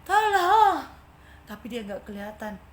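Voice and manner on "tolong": high, loud cry of tolong; shout for help. Acted. Trapped ant shouts for help. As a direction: yell out for rescue. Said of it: "dengan teriak".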